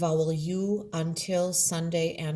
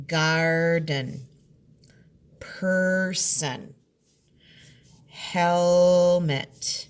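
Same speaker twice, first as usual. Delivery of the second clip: each word said louder and split into its syllables; 6 dB louder, much slower than usual. Shown as a demonstration. Teacher to third graders showing syllable division, loud and split. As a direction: sound out every syllable for the class. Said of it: "I want to show you the syllable division".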